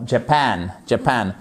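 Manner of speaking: Japan with the second syllable stressed. Shown as a demonstration. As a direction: lean on -PAN in each Japan. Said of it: "Japan et pas Japan". (Japan, accent tonique sur la seconde syllabe)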